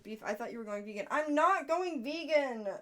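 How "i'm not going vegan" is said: loud, high and slow, fed up; indignant shout. Real, not acted. Protesting to the viewer, loud and indignant. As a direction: insist it loudly, offended.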